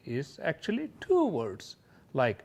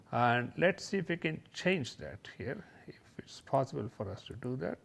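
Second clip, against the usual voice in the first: soft mumbled aside while working; talking to oneself; 8 dB quieter, faster than usual. Real, not acted. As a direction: think aloud quietly, half to yourself. Talking to self while editing slide.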